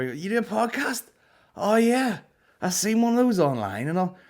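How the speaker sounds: high, loud, excited Belfast bloke voice; mocking the bar guy. Comedian mimics a rude bar guy recognising him, high and loud. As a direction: ham up a nosy punter spotting a comic. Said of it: "and he".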